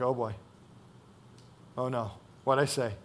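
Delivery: sheepish, under your breath, afraid you blew it; quiet mock alarm at their tears; worried mumble. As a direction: drop low and quiet, a nervous oh no. Imagined panic after a wrong word.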